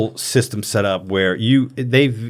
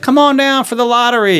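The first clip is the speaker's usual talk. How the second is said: mock promo pitch; 11 semitones higher, 4 dB louder than usual. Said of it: "these stings where you're like".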